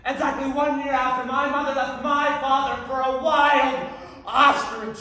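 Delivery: loud, high theatrical wail; melodramatic outburst. Acted. Telling the audience of mother leaving, melodramatic outburst. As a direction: belt out the family tragedy.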